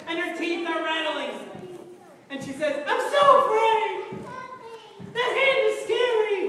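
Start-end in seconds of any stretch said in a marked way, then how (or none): 2.9-6.4 high trembling-scared king, hammed-up; mock fear. King seeing the writing hand, high mock fear. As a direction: wail it like a frightened king.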